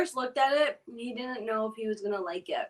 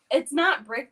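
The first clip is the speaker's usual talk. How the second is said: normal talking voice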